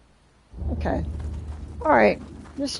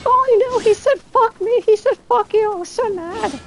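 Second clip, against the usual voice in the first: much higher, whiny mock of an upset girl; imitating a chick complaining, pitched way up; mock girly voice; 14 semitones higher, 4 dB louder than usual. Streamer mocks how a girl would complain, high whiny voice. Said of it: "you'd be like".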